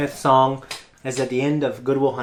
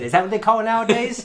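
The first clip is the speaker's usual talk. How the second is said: higher-pitched mock sarcasm; put-on higher voice, needling; retold sarcastic dad; 7 semitones higher, faster than usual. Dad doubting flashlight tag, imitated sarcastically.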